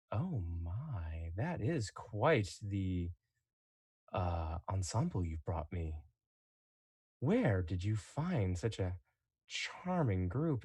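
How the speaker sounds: silky, savouring Inquisitor. Acted. Inquisitor appraising the captured group, soft, slow and savouring. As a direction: savour each word with mock-polite delight. Game master plays the Inquisitor.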